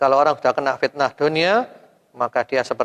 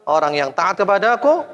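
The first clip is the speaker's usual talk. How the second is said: ordinary delivery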